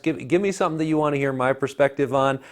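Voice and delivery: ordinary talk, nothing marked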